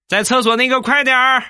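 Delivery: high-pitched loud holler over a loudspeaker; 喇叭大声喊话. Acted. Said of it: "喇叭里边大声地喊道". (像喇叭广播一样高声大喊)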